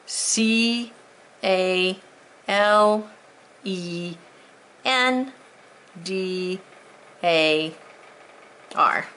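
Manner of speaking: one letter at a time, long gaps; spelled out. Shown as a demonstration. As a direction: spell it slowly so students can write.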